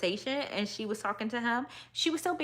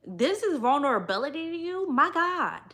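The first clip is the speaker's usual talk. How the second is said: incredulous; 6 semitones higher than usual. Real, not acted. Incredulous reaction to quote.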